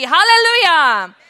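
loud, high, drawn-out praise cry; exultant shout. Real, not acted. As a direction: belt it out to the congregation.

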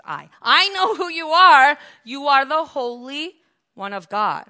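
high, loud declaration, easing a little; crying out. Acted. Spirit naming Jesus the Holy One, crying out. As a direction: cry out the recognition loudly. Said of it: "starts to cry out".

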